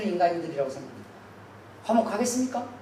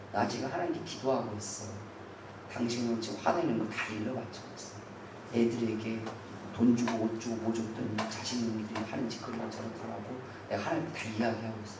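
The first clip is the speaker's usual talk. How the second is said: calm wife's reply; 8 semitones lower, 11 dB quieter than usual. Retold or imitated. Wife answers husband calmly.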